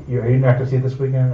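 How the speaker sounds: ordinary talk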